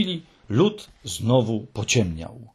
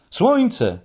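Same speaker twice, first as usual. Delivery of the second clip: high-pitched echoing cry; high call bouncing back; 10 semitones higher, 4 dB louder than usual. A narrator's reading. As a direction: repeat the cry like an echo. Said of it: "powtarzało echo".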